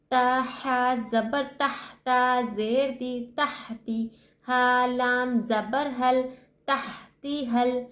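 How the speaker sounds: drawn-out spelling of तहती हल, लाम joined to the vowel before it; teacher chanting letters for pupils; slow tajweed spelling. Shown as a demonstration. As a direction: spell each syllable slowly, then join. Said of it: "लाम को पिछली वाली हरकत से मिलाकर पढ़ रहे हैं".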